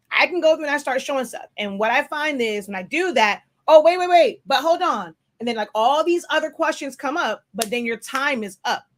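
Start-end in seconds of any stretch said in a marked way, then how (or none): 3.7-5.2 eager student cutting in; interrupting student. Retold or imitated. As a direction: wait wait, stop the teacher.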